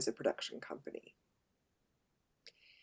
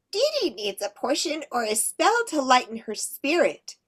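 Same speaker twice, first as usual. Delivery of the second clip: high, bright little fairy; 8 semitones higher, 4 dB louder than usual. Shown as a demonstration. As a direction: voice a tiny fairy, higher. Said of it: "So remember, it's fairies".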